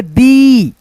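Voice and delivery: दी with a long, held "eee" vowel; drawn-out ई. Shown as a demonstration. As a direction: stretch दी out slowly as "deee". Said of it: "ई बोल रहे हैं दोस्तों खींच करके".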